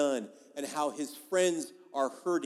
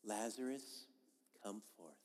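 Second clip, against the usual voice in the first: mocking a timid Jesus, soft and much quieter; sweet and meek; 4 semitones lower, 15 dB quieter than usual. Pastor mocks a meek Jesus calling Lazarus, very soft. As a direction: call him out like a dainty porcelain figurine, barely audible. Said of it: "like a Precious Moments figurine".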